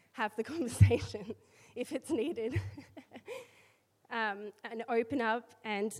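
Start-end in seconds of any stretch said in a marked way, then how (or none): 0.1-2.7 laughing. Real, not acted. Laughs mid-word at own joke.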